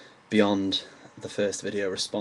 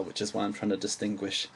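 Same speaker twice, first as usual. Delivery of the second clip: ordinary talk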